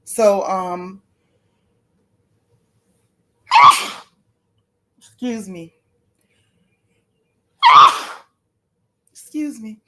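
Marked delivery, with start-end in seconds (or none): 3.5-4.0 loud sneeze. Real, not acted. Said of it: "I can't stop sneezing". 7.6-8.2 loud sneeze. Real, not acted. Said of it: "I can't stop sneezing".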